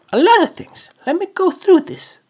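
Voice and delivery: high, eager voice; excited high. Real, not acted. Starting the weekly recap, eager and high. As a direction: jump up into an eager list.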